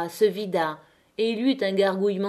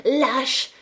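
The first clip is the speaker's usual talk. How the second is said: high, choked cry of outrage; indignant cry; 5 semitones higher, 3 dB louder than usual. Acted. Catherine screams at Étienne, choked with outrage. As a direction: spit the word out in indignation. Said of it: "lui cria sous le nez étranglé d'indignation".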